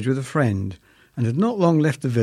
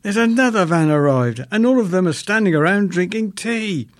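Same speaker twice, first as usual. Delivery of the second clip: higher, louder mock-yelled complaint; 8 semitones higher, 4 dB louder than usual. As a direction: yell it to nobody, raised and louder. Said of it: "I yelled to an empty car".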